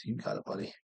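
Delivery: hushed, resigned murmur; whispered aside. Real, not acted. Player grumbling to his opponent, whispered. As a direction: say it under your breath to the opponent.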